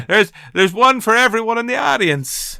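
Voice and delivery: hammed-up chat show giveaway in a put-on accent. Host parodying a toy show giveaway, high excited presenter impression.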